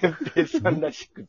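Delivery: high laughing voice; laughing while talking. Real, not acted. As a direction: laugh through the words. Laughing about his answer.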